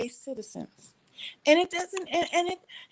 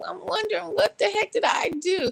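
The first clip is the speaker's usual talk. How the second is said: alarmed; 9 semitones higher than usual. Retold or imitated. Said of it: "And I'm like".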